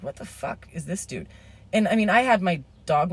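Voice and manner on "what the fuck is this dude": muttered. Retold or imitated. Inner reaction, muttered.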